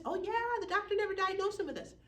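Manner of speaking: high, bright-eyed relative answering; a family member caught off guard, voiced high; mom's child, surprised, pitched well up.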